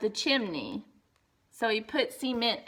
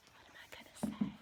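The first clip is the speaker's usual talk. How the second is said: whisper; 13 dB quieter, much faster than usual. Real, not acted. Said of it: "reader whispers to child".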